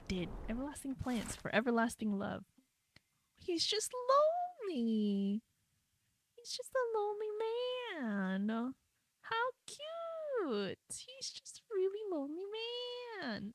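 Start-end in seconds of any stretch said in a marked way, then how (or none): 3.4-13.5 high cooing sympathy; mock pity. Real, not acted. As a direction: coo it like over a puppy.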